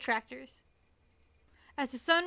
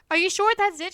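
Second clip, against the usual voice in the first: ordinary delivery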